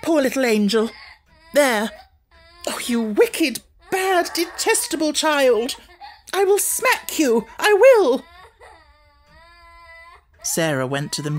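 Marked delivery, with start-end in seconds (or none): 0.0-1.9 high pitying coo; cooing again. A narrator's reading. Miss Amelia flipping back to pleading, cooing. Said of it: "another tone". 2.7-8.2 high, loud, slow furious threat; furious scolding. A narrator's reading. Miss Amelia losing her temper at Lottie, furious. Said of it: "another tone".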